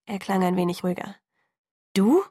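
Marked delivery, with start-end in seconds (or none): 1.9-2.2 sharp rise, higher and louder; incredulous. A narrator's reading. Bella reacts to his having heard, incredulous. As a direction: question it in disbelief, pitch shooting up. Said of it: "fragte ich ungläubig".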